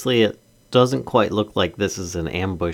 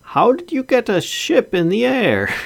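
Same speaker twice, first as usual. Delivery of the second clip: much higher, bemused asking; incredulous; 8 semitones higher than usual. Acted. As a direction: ask it in disbelief. Hero questions NPC incredulously.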